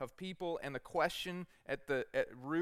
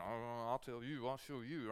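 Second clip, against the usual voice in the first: low muttered grumble, a bit softer; grumbling under breath; 3 semitones lower, 5 dB quieter than usual. Retold or imitated. Preacher imitating someone grumbling after being cut off in traffic, muttered. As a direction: mutter it under your breath like a stung driver. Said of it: "to speak under your breath".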